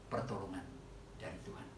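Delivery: usual speaking voice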